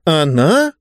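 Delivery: can't believe it; astonished. Acted. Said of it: "ещё больше изумилась Диба".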